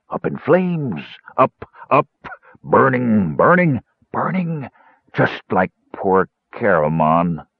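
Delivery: put-on slurred, drawn-out drunken wailing; drunken would-be wizard.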